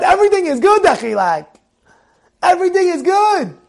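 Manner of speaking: high, lilting reassurance; demonstrated upbeat acceptance, pitched high; cheerful acceptance. Shown as a demonstration. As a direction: sing out the phrase as if shrugging off trouble. Models cheerful acceptance of annoyances.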